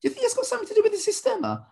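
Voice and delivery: high, fast mock-eager student voice; imitated client. Client asking the coach about systema, high and eager. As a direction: squeak it out like a hopeful student. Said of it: "And they'll say".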